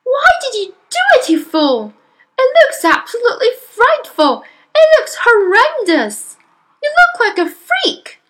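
shrill, very high horrified scolding; shrieking mother. Acted. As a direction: shriek it loudly at your husband. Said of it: "shrieked the mother".

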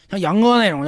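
ordinary talk, nothing marked

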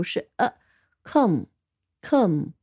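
"come" said slowly with a reduced schwa vowel; unstressed "come", vowel weakened to a, drawn out; weak vowel. Shown as a demonstration. Teacher showing the weak first syllable, slowly. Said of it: "这个元音就是读的是弱读".

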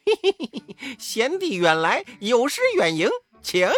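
laughing welcome. A narrator's reading. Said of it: "猴王笑着".

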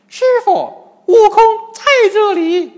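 very high, full-volume cry; loud answering shout. A narrator's reading. Wukong revealing himself to his master, high shout. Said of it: "金蝉老妖大声答应".

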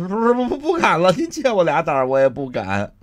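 high, loud, stuttering begging; stammering plea. Acted. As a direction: beg for mercy, tripping over words. Thug begs, stammering.

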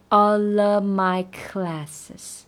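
all of my classes said very slowly; slow demo. Shown as a demonstration. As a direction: drag it out to show linking. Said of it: "我们可以慢速地听一遍". (慢速示范)

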